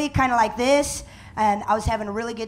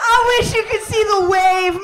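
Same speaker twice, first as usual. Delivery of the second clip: excited; 7 semitones higher, 4 dB louder than usual. Real, not acted. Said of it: "excited about mom".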